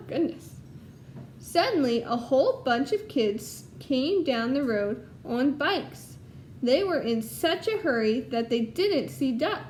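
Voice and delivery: lively storybook read-aloud to a class; reading to children. Acted. Teacher reading Duck on a Bike to her class, storytelling voice. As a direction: tell it brightly so the kids follow along.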